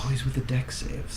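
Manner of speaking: soft unvoiced whisper. Real, not acted. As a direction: whisper it under breath. Whispered aside at table.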